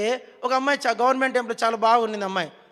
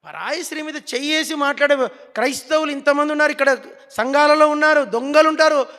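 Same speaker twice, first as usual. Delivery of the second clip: loud, driving pulpit voice, hammering each line; fiery preaching; 3 semitones higher, 6 dB louder than usual. Acted. Pastor rebuking the church's thieves, fiery preaching. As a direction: preach it hard at full force.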